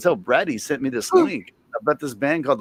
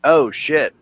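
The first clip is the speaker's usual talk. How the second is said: ordinary delivery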